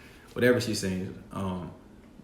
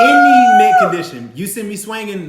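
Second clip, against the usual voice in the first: excited shout; 11 semitones higher, 14 dB louder than usual. Real, not acted. Naming her top five to the host, shouting with excitement. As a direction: shout it out, high and loud with joy.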